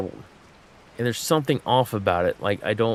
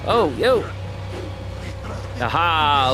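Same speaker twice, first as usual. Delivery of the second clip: excited outburst; 6 semitones higher, slower than usual. Real, not acted. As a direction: burst out in triumphant surprise. Exclaims at a success in game.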